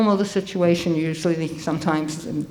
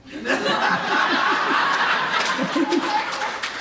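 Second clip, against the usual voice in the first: long real laugh; breathy laughter, thoroughly amused; 5 semitones higher than usual. Real, not acted. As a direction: crack up at length. Laughs after deadpan "Oh.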